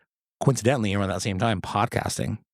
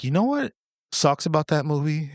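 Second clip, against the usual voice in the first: dad's question pitched up high; quoting dad; 7 semitones higher than usual. Retold or imitated. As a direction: lightly mimic a dad posing a question, higher. Retelling dad's question after a movie.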